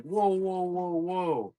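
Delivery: high stretched whoas; mock alarm. Dad stopping his son's big order, high and stretched. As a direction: hold the kid back in mock alarm.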